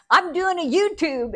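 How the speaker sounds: high, loud, proud retold reply; chirpy announcement. Daughter telling dad her new venture, chirpy. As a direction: sing out your news brightly to a parent.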